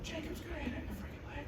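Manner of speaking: very soft, high, quick aside; hushed aside. Retold or imitated. Golfer privately predicting rival's miss, hushed aside. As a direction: say it under your breath.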